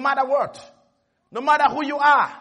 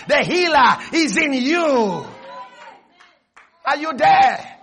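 ordinary talk, nothing marked

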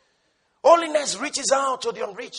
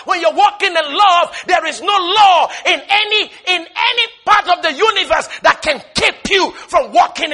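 loud, higher, rolling sermon cry; full-force preaching; 6 semitones higher, 8 dB louder than usual. Acted. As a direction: preach it out to the back pew.

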